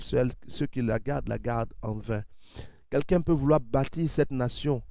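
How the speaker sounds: normal talking voice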